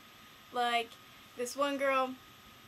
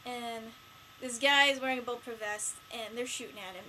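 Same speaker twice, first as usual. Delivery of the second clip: normal talking voice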